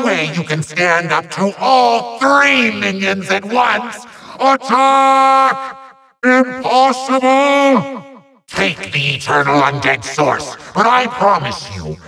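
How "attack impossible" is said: high, drawn-out yells; outraged cry. Acted. Villain sees all three minions fail, high drawn-out cries. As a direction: yell it with theatrical force. Villain attacks, then is beaten.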